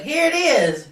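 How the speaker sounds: high, loud showy announcement; mock unveiling. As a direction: present it with fake fanfare. Acting out a triumphant unveiling.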